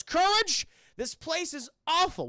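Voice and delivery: high, loud cartoon outburst; exasperated scolding. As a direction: call out sharply, then grumble. Muriel scolds Courage after rescue.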